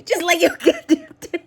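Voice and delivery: high voice collapsing into laughter; words swallowed by a laughing fit; breaking into laughter. Real, not acted. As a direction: repeat it while cracking up.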